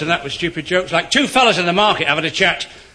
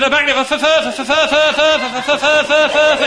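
high, louder stuttering punter; louder stammer; 9 semitones higher, 4 dB louder than usual. Acted. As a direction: push harder through the stammer. Stammerer more agitated.

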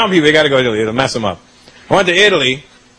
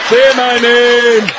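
higher, long drawn-out plea; passionate lover; 4 semitones higher, much slower than usual. Pretend. Man in bed with his woman, drawn-out mock-passionate plea.

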